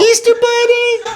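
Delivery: high shrill mimic call; mock child call. Friend mocking her call to the bunny, high. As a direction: shout it like the kid did.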